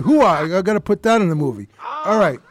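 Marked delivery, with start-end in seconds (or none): 0.0-1.3 short mock Pacino bark of hoo-ah; a hint of Al Pacino's hoo-ah. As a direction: punch it like a hammed-up Pacino. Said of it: "another part I did".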